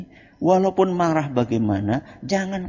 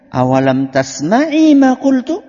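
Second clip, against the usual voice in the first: loud; 6 semitones higher, 6 dB louder, slower than usual. Acted. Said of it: "Nabi ... balik bertanya".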